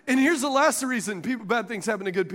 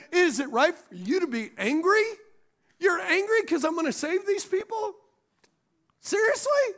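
mock disbelief, questions lifting higher and higher; incredulous Lord; 7 semitones higher than usual. Preacher voicing the Lord questioning angry Jonah, incredulous. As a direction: pitch climbing to a high, disbelieving question. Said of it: "Then the Lord said".

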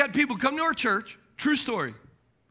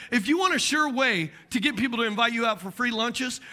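ordinary talk, nothing marked

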